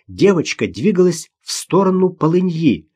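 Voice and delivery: usual speaking voice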